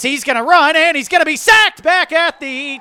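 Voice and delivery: rising excited sack call; excited play-by-play. Real, not acted.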